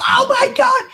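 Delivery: retold wife shrieking in shock. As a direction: imitate a horrified shriek. Wife shrieks at another door.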